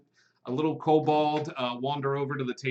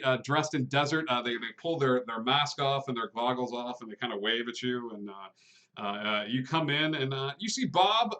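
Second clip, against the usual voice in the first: ordinary delivery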